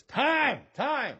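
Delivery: high mock coach's call; calling time. Coach halting the game, calling out.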